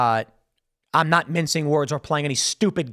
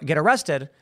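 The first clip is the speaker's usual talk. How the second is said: usual speaking voice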